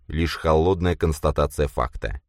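usual speaking voice

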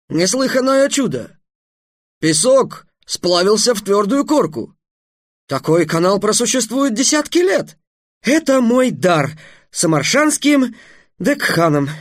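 high, awed exclamation; amazed. A narrator's reading. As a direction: marvel at a miracle. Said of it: "Удивлённо сказал". (удивлённо и восхищённо)

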